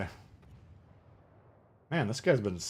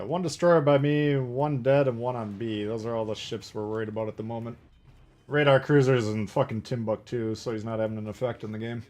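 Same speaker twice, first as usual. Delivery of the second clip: ordinary talk, nothing marked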